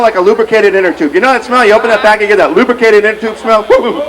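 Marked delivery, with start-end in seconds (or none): 3.7-4.0 high-pitched "woo-hoo"; mock-overwhelmed high hoot; high whoop. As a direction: reel from a strong smell with a whoop. Reacting to the strong smell.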